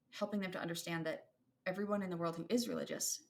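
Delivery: ordinary talk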